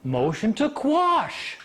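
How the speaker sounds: high, very drawn-out proclamation; acted-out slow, stubborn announcement; emphatic declaration. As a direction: stretch it out insistently. Brother insists again, acted out.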